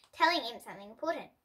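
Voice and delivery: ordinary delivery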